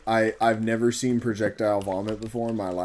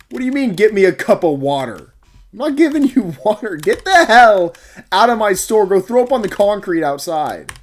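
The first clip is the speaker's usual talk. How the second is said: high, loud outraged rant at the customer; indignant rant; 10 semitones higher, 11 dB louder than usual. Real, not acted. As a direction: vent the outrage loudly all the way through. Ranting at the customer now.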